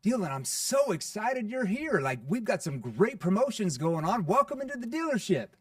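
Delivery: loud, high, gushing welcome; pushy dealer bursting with excitement at the door; overexcited car salesman. Retold or imitated. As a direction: oversell the greeting with huge enthusiasm. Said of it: "the salesperson comes up".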